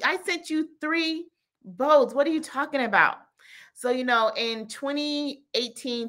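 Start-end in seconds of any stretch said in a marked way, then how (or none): 0.0-3.2 high-pitched mock disbelief; exasperated comic comeback; indignant God. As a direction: drag out the reminder, then snap the question quickly. God's indignant reply, repeated.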